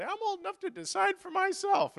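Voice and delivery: put-on high, whiny teenage girl; teen daughter. Pastor imitates his daughter Jenny protesting, high-pitched. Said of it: "And she'd say".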